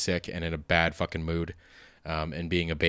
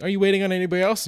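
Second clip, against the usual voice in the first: high and fast waitress voice; mock waitress; 12 semitones higher, 4 dB louder, much faster than usual. Waitress asking the solo diner, high and quick. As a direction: rattle it off like a busy server.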